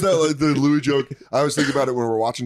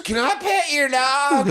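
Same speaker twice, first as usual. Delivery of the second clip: homeless lady voice; 14 semitones higher, much slower than usual. Retold or imitated. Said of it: "She goes".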